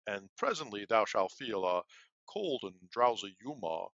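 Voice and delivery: wild put-on apothecary voice, pitch jumping; [name] as the apothecary handing Juliet poison, hammed-up voice. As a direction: ham up the apothecary offering poison. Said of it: "doing the apothecary in a different voice".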